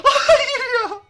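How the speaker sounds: high, loud wail breaking into laughter; laughing scream. Real, not acted. Gamer laughing at his own defeat, high laughing wail.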